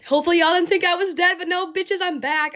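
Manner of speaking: upbeat bragging return; excited comeback. Real, not acted. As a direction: burst back in with a triumphant yell. Announcing comeback to viewers.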